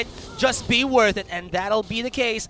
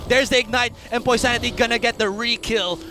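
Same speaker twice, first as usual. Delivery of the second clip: excited play-by-play; 5 semitones higher, 7 dB louder than usual. Acted. Live play-by-play of the fight.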